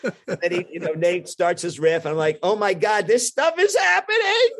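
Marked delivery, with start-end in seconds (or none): 2.4-4.5 high-pitched, loud freak-out; comic terror climbing to a screech; mock panic. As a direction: squeal it, rising into a hammed-up shriek. Acts out panicking as the riff starts.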